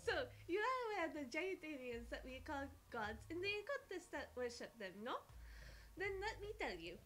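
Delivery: put-on playful French-accented storyteller; horrible French accent. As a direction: tell it with a hammed-up French accent. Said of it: "Excuse my horrible French accent".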